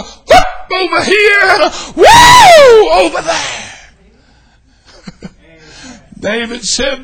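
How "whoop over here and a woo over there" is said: high, very loud whooping shout; war whoop shout. Acted. Preacher describing his own war whoop, shouting. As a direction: let out a joyful war whoop. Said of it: "one of my war whoops".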